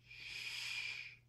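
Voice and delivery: soft, voiceless shushing; whispered hush. A narrator's reading. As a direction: shush someone very quietly. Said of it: "the others whispered".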